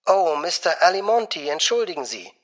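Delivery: high, soft, honeyed woman's voice; warm, gushing. A narrator's reading. Secretary greeting an expected caller, warm and honeyed. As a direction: melt into warm apology. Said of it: "ihre Stimme wurde so warm".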